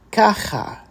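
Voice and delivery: "Ka, cha" joined up like one word. Shown as a demonstration. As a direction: repeat "Ka, cha" briskly, kh from the throat. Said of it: "just a little bit more quickly".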